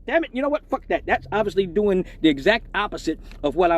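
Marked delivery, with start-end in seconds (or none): none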